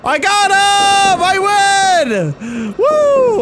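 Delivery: high, loud drawn-out victory yell; triumphant cheer. Real, not acted. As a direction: whoop at winning. Wins the game.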